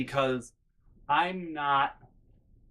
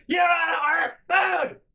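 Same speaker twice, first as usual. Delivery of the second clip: high, loud imitated scream; mom yelling; 6 dB louder than usual. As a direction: yell it like the irate mother. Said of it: "my mom's still yelling at me".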